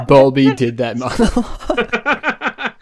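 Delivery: slow, laughing through words. Real, not acted. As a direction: laugh as you speak. Laughing while telling.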